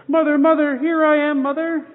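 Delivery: high and loud, eager cries that soften at the end; excited calling. Acted. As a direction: run up shouting for joy. Bird runs up calling mother.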